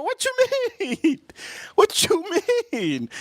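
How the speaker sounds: very high, squeaky disbelief; hammed-up high-pitched protest; mock bafflement. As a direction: squeal the question in exaggerated confusion. Kid baffled by the father.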